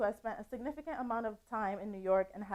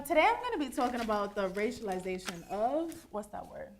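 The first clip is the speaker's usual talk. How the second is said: ordinary talk, nothing marked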